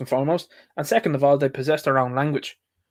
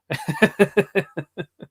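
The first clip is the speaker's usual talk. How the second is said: short laugh; 4 semitones higher than usual. Real, not acted. Mocking his own Ali G joke.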